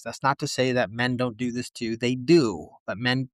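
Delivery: ordinary talk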